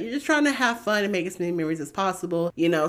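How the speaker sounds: ordinary talk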